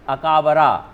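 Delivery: अकाबरा with a vowel after each consonant; every vowel sounded. Shown as a demonstration. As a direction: spell out अकाबरा as a-kaa-ba-raa. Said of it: "अगर नियम के आधार पर चलेंगे". (अकाबरा, हर अक्षर में पूरा स्वर)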